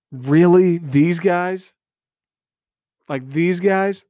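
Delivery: really, these guys? in disbelief; incredulous disbelief. Real, not acted. Fan ranting about upset loss.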